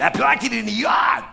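put-on high, shouted Boston accent. Bostonian answering where he parked, shouting Boston accent.

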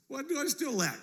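high-pitched mock smug question; haughty. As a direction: ask loftily, as if nothing is missing. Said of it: "the dude's kinda haughty".

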